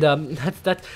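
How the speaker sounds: normal talking voice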